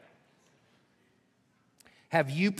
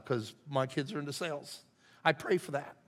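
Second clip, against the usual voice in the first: ordinary talk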